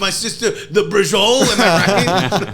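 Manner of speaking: loud, high East Coast wise guy; hammed-up, drawn-out East Coast accent; mock East Coast accent. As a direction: shout it like a cartoon New Jersey guy. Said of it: "East Coast-ish accent as their dumb person".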